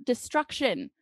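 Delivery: ordinary delivery, nothing marked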